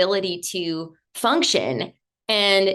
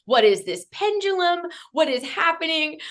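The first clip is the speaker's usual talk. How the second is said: higher, panicky run of questions; freaking out; 8 semitones higher than usual. Retold or imitated. At the healers' retreat, panicking over chakras. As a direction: retell the panic, voice climbing. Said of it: "I'm freaking out going".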